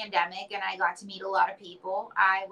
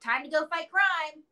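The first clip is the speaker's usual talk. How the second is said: put-on higher, sing-song nurse calling a child; coaxing nurse; 7 semitones higher than usual. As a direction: gently coax the boy back to his room. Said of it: "a nurse goes".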